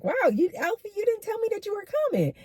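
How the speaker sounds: high-pitched, surprised retold quote. Woman greeting an unexpected visitor, surprised.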